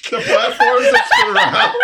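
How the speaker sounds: laughing high and loud. Real, not acted. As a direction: squeal it through laughter.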